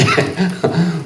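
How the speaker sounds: a short real laugh; a quick laugh; amused laugh. Real, not acted. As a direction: laugh at how blunt it is. Laughs at the frank text.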